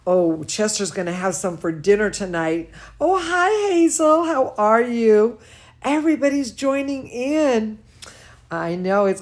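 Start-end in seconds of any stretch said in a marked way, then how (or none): none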